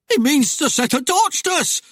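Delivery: squealed, alarmed cry; high-pitched panic. A narrator's reading. Husband realises they will burn, voice pitched high. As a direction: let the voice shoot up in terror. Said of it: "Timmy's voice hit a high pitch".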